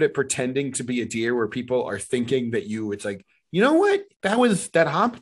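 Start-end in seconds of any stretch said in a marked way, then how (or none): 3.5-5.2 mock impressed audience. Mocking impressed improv audience.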